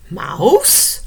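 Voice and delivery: rising uncertain call; timid bear calling his friend; nervous call. Acted. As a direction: call into the dark, unsure. Frightened Bear calls out.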